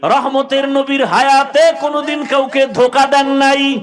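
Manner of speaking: high, loud chanting sermon delivery; full-force preaching. Real, not acted. Preacher rousing youth about the Prophet's example, high full-force chant. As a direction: preach it high, stretching the last words.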